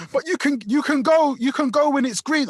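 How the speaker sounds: high, pleading-to-explain mimicry; woman protesting. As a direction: imitate a defensive driver's reply. Voices the woman answering back.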